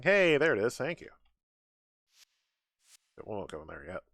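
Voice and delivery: ordinary talk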